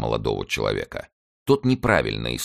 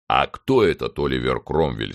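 plain reading voice

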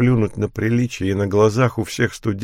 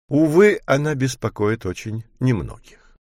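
ordinary delivery, nothing marked

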